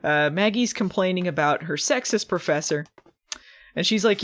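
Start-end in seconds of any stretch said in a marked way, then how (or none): none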